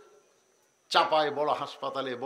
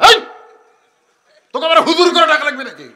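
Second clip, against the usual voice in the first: father's rebuke; 10 semitones higher, 15 dB louder, faster than usual. Acted. Father scolding son in public.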